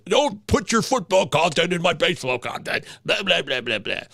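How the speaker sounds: mocking a grumpy baseball fan; lightly voiced complaining listener; whiny listener. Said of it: "I love the imitation that you were doing there".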